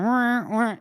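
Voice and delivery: high, wheezing mock accordion noise; comic vocal accordion squawk; off-key accordion imitation. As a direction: honk like an out-of-tune squeezebox. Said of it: "an off-key accordion".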